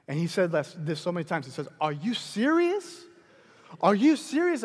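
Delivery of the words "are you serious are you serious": imitating a stunned man, pitch raised; incredulous. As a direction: question it in astonished disbelief. Retold stunned reply to generous offer.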